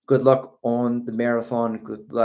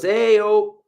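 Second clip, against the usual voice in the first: higher, louder Boston greeting; Boston accent; 10 semitones higher, 6 dB louder than usual. Acted. Host reads a viewer's greeting, Boston accent. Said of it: "I did hear some of those accents".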